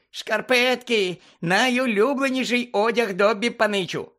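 high, squeaky, thrilled creature voice; delighted elf. A narrator's reading. House-elf receiving old socks from Harry, overjoyed squeak. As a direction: squeal with joy over a gift. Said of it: "Доббі був страшенно задоволений".

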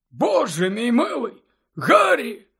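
high-pitched character voice, astonished; surprised. A narrator's reading. Dumbledore to his late-night visitor Harry, surprised. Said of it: "здивувався Дамблдор".

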